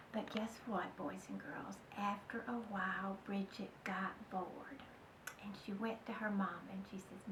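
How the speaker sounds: put-on hushed suspense for the children; storytelling whisper. A narrator's reading. Narrator confiding to the children, in a secretive whisper.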